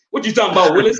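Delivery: catchphrase impression. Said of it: "Me and the Willises".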